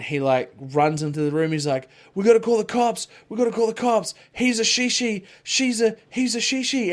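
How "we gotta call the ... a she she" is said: mimicked panic, high and loud; freaking out. Retelling a son's panic in a movie scene, high mock freak-out. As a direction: imitate a kid yelling in alarm, pitched way up. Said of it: "was, like, freaking out".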